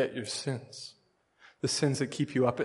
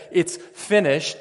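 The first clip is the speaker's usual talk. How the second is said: slow, louder, higher proclamation; solemn declaration; 5 semitones higher, 7 dB louder, slower than usual. Acted. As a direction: proclaim it slowly and loud. Jesus on the cross quoted.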